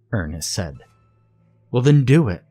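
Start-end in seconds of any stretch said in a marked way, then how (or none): 1.7-2.4 loud, higher goading; urging. A narrator's reading. Leonard dares Ernest to tell, urging loudly. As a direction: dare him with force. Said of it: "Leonard urged".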